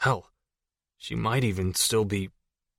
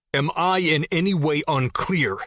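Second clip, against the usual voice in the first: raised, firm threatening demand; loud, hard demand; 6 semitones higher than usual. Acted. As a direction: stare him down and demand it. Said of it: "I demanded in a loud, hard voice".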